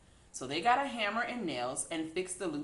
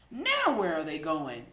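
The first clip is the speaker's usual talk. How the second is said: in surprise; 6 dB louder than usual. A narrator's reading. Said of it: "asked in surprise".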